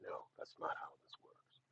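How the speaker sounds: a soft, whispered no; whispered refusal. Retold or imitated. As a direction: turn her down under your breath. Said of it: "I'm like".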